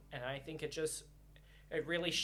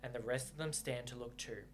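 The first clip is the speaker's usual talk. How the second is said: story narration; 5 semitones lower than usual. A narrator's reading. Reader narrating the novel to viewers, calm.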